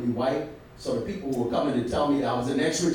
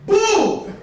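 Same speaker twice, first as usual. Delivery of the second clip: sudden loud high boo; mock heckler yell; heckler's boo; 7 dB louder than usual. Heckler yelling boo in crowd.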